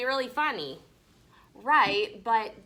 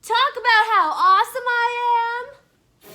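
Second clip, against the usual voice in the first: loud, high blurt across the classroom; shouted out; 12 semitones higher, 11 dB louder than usual. Acted. Boy shouting out in class to the teacher, loud and high. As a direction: yell it out to show off. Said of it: "I shouted out".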